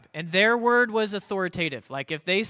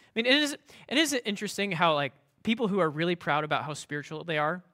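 usual speaking voice

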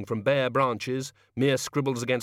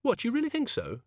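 lightly surprised question; 12 semitones higher than usual. A narrator's reading. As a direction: pitch jumps up in disbelief. Susan surprised by idea.

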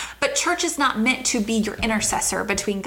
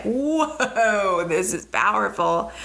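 drawn-out, marvelling whoa; reliving being blown away; awed reaction; much slower than usual. Retold or imitated. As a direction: stretch the first word out in wonder. Acts out her awed first reaction.

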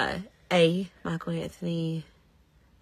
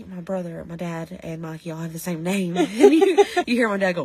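ordinary delivery

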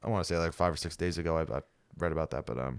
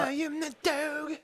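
silly character voice; much slower than usual. Pretend. Mock Dog the Bounty Hunter.

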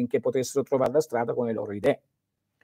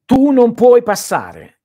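forte e lento, voce alta e solenne; Gandalf che sbarra il passo; 9 semitones higher, 13 dB louder, slower than usual. Retold or imitated. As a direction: tuona come Gandalf sul ponte. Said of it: "mi sono commosso perché ho visto". (Gandalf grida al Balrog, voce alta e solenne)

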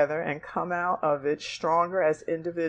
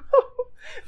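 teary laugh. Real, not acted. Reading letter, tearful.

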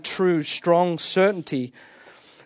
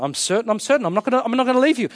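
higher, hurried, over-certain insistence; Peter, emphatic certainty; 6 semitones higher, much faster than usual. Retold or imitated. Disciple promising Jesus he won't leave, emphatic and certain. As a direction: blurt repeated assurances in a high rush. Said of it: "he said emphatically".

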